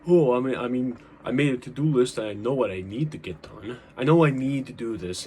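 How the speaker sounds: playing your own lazy excuses aloud; mock self-excuse. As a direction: brush it off like it's handled. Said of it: "this trap of, like".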